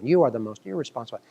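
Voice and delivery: ordinary talk, nothing marked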